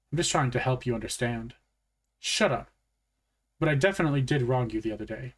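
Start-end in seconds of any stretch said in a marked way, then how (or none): 2.2-2.7 irritated retort. Acted. Same boy cutting the narrator off.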